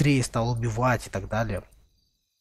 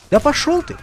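annoyed outburst; 9 dB louder than usual. Real, not acted. Enemy in game.

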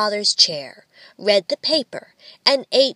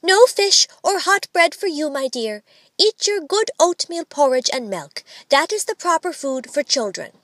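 high, prim refusal; lightly acted motherly firmness; strict mother; 6 semitones higher than usual. A narrator's reading. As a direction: lay down the rules to a child. Child playing strict mother at breakfast.

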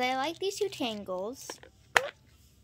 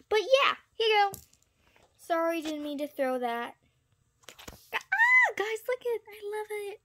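high, loud delight; excited squeal; 7 semitones higher, 6 dB louder than usual. Pretend. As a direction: squeal over the new loot. Pretend trader gets overpaid.

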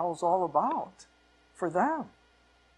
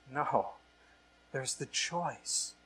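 earnest; 5 dB quieter, much slower than usual. Real, not acted. Quiet slow climax after joke.

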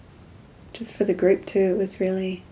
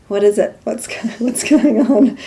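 laughing retort; 4 semitones higher, 7 dB louder than usual. Retold or imitated. Friend presses her on a call.

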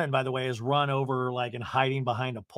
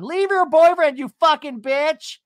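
high, loud hammed-up tirade; mock furious yell; 13 semitones higher, 10 dB louder than usual. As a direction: imitate a reality star yelling at a rival. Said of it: "she's yelling at Jen".

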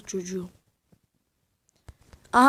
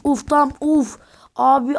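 ordinary talk, nothing marked

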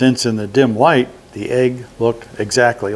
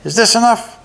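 high goblin voice; 10 semitones higher than usual. A narrator's reading. Goblin offering gold.

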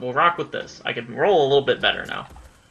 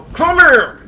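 yell; 11 semitones higher, 5 dB louder, slower than usual. Real, not acted. Yelling mid boss fight.

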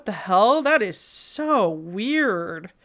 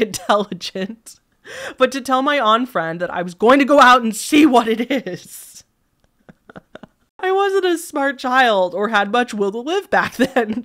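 narration breaking into real laughter; laughing while reading; 3 semitones higher, 6 dB louder than usual. Real, not acted. Reader amused by the child's unwise plan, laughing as they read. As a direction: read it through a laugh, getting louder.

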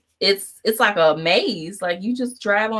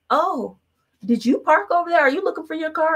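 a light, much higher voice for the helpful lady; helpful stranger; 10 semitones higher, 3 dB louder than usual. Retold or imitated. A stranger approaching them about the car, lightly voiced high and helpful. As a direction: chirp it up high like a concerned passer-by. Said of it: "some lady comes up to us and was like".

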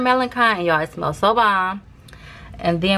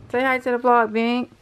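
higher sing-song coaxing; coaxing pet voice; 5 semitones higher than usual. Real, not acted. Asking Bink to greet the vlog, higher coaxing voice. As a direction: coax the pet sweetly to say hi.

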